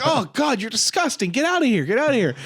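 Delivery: playful imitation of a repulsed woman yelling; mock disgusted outburst. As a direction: shoo him out in a shrill mock huff. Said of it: "And she's like".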